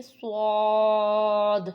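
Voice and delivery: slow thick سواد; heavy saad. Shown as a demonstration. Teacher modelling the heavy long سواد. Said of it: "موٹا ادا کرنا ہے".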